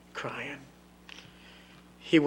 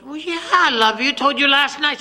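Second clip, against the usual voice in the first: put-on high, loud child voice; a child insisting; little girl; 12 semitones higher, 12 dB louder than usual. Little girl tells daddy she loves him, high and loud. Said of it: "She goes".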